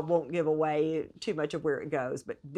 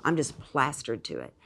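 ordinary talk

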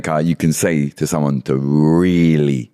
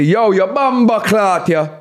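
high, stretched mock cussing; mock Jamaican insult; 12 semitones higher, slower than usual. Shown as a demonstration. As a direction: call it out high and slow. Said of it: "where you could say".

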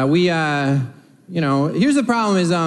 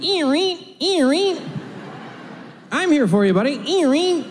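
high, eerie singsong for a machine; talking hand foam dispenser; 9 semitones higher than usual. Retold or imitated. Comic imitates the hand foam dispenser, high eerie singsong. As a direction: croon like a creepy soap dispenser. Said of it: "motion sensor hand foam is like".

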